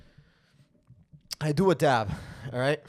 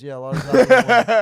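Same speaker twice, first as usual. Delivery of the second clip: much higher, much louder cover-up; squeaky fake agreement; 8 semitones higher, 13 dB louder than usual. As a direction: overdo the innocent yes. Lying about the dab to girlfriend.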